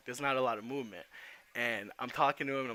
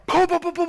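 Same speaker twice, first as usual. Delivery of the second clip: much higher, loud booming beats; imitated door bangs; 14 dB louder than usual. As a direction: bang out each boom like slamming through a door. Retelling bursting through the door.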